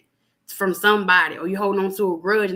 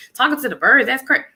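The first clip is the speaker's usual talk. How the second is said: loud, higher-pitched mimicked scoffing; mock disbelief; 5 semitones higher, 6 dB louder than usual. Imitating people who think bird talk is crazy, mock disbelief. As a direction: imitate a doubter scoffing loudly.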